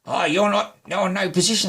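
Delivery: high-pitched imitation of a magistrate; retold rebuke, pitch well up; rebuking magistrate. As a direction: act out an official putting you down.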